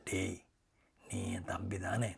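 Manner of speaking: put-on older brother whispering to bribe his little brother; secret whisper. A narrator's reading. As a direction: hush and coax him like a fellow conspirator. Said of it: "மெதுவான குரலில் நான் கேட்டேன்".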